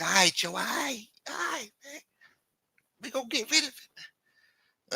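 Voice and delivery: soft, very high falsetto agreement; meek squeaky voice. Retold or imitated. Lameek agreeing to ditch the car, meek high squeak. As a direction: squeak out a placating yes.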